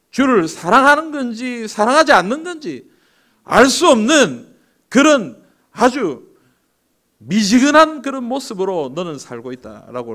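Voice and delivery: high, slow scolding rebuke; a preacher voicing Christ's reproach; stern rebuke. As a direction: scold them for being lukewarm, drawing it out. Jesus rebuking lukewarm church.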